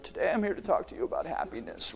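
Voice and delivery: mock a bored speaker; flat and lifeless. Shown as a demonstration. Coach mocks a speaker delivering happiness flatly. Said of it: "this is how they deliver it".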